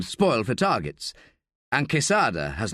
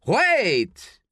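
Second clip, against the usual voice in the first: sharp high yell of alarm; sudden urgent shout, much higher; 10 semitones higher than usual. A narrator's reading. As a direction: cry out to stop him. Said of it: "The other handler yells".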